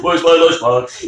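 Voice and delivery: slow, dragged-out dimwit voice; mock idiot. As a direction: whine it slowly like a dopey kid. Idiot act continues.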